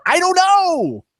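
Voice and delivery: slow, high, stretched I don't know; drawn-out reply. Retold or imitated. Replying to Natalie at the charity banquet, high and slow. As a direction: answer helplessly and drawn out. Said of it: "I went".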